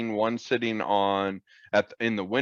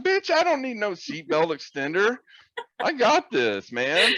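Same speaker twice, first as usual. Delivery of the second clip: indignant; 10 semitones higher than usual. Retold or imitated. Indignant retort in story.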